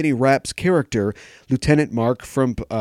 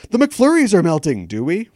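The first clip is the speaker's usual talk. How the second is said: mock scream; 6 semitones higher, 5 dB louder than usual. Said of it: "quoting mock scream".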